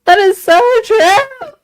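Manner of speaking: giddy fangirl squeal of agreement; excited squeal. Real, not acted. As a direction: squeal it out in delight. Gushing agreement, repeats phrase squealing.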